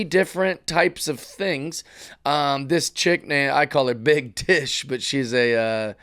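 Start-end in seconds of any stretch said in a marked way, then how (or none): none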